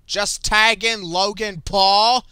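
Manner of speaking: slow, loud, fed-up demand; exasperated shout. Real, not acted. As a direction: spell it out loudly and slowly. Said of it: "I don't know".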